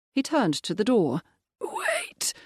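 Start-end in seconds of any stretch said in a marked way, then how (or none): 1.6-2.3 far higher, softer strained gasp; a dying man reaching out; wheezed plea. Acted. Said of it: "the Viscount wheezed".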